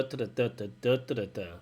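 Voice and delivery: linked "duh" syllables with a falling close; natural intonation. Shown as a demonstration. Teacher repeating the sentence melody on "duh", natural and flowing. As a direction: let each "duh" flow, then drop at the end. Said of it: "as opposed to".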